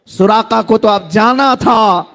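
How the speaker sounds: ordinary talk, nothing marked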